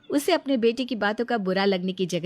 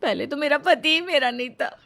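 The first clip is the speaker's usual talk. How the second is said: higher, crying voice with a bitter laugh; weeping wife pouring out her hurt, higher; tearful lament; 6 semitones higher, 3 dB louder than usual. Acted. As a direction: speak through tears, half laughing at it. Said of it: "बैठकर रो रही थी".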